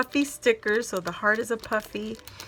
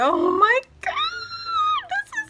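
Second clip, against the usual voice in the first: excited squeal; 5 dB louder than usual. Real, not acted. Discovering the Marigold papers.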